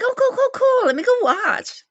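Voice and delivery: high, loud, quick-repeated enthusiasm; eager excitement. Retold or imitated. Streamer retelling seeing a friend's new video, eagerly excited. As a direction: act out bubbly eagerness at a find.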